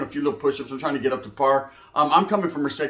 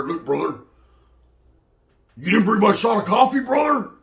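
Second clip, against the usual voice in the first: demanding inmate; 4 semitones higher, 3 dB louder than usual. Retold or imitated. Voicing a Wood asking coffee.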